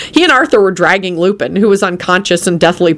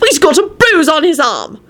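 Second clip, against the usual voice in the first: alarmed cry; 10 semitones higher than usual. A narrator's reading. Elizabeth fussing over Lupin's injuries.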